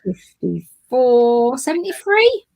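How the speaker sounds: rising high, doubtful about the sum; unsure questioning. Real, not acted. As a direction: pitch climbs up to seventy-three. Unsure working out age.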